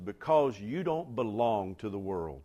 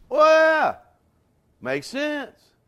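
mock shrug; 14 semitones higher, 12 dB louder, much slower than usual. Church shrugs at hostile world.

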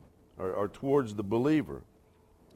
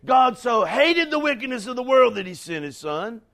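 shouted much higher and louder; mock misquote shout; 10 semitones higher, 10 dB louder than usual. Preacher shouting the false version of the verse, loud. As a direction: bellow the wrong verse like an accusation.

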